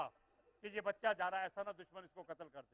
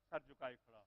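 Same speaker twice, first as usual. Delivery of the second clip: ordinary talk, nothing marked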